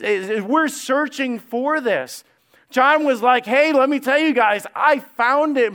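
loud, high, bursting with joy; excited. Retold or imitated. John telling his friends, joyful loud excited discovery. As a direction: shout the discovery out with delight. John voiced, found love.

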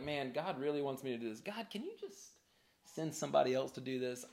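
hushed, sheepish plea to God; soft mock pleading; reluctant prayer. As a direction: murmur it privately, hoping to be let off. Voicing a reluctant private prayer.

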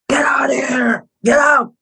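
high, strained mock shouting; imitated coach's order. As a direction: mimic an exasperated coach throwing players out. Coach throwing the players out.

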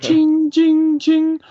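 high sung ching noise; cash register ching. Retold or imitated. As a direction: mimic a till ringing.

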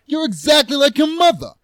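put-on high-pitched Jersey accent tirade; heated Jersey-accented scolding; strong Jersey accent. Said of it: "I love the strong accent choices".

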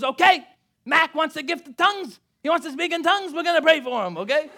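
rally the family to pray like an excited mom; mom leading prayer. Retold or imitated. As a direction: mom announcing a prayer over her son. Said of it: "She goes".

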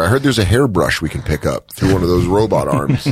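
ordinary talk, nothing marked

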